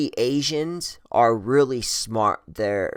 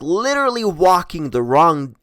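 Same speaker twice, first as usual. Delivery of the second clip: normal talking voice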